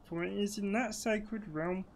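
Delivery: high, slow, swooping theatrical voice; grand, drawn-out game exposition; storybook tree voice. Acted. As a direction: intone it slowly as an ancient storyteller tree. Reads the tree's exposition.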